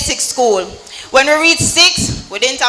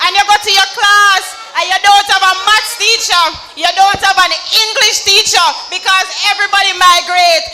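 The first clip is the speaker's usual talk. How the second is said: rally speech; 5 semitones higher than usual. Real, not acted. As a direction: rouse the crowd with conviction. Fiery youth rally speech.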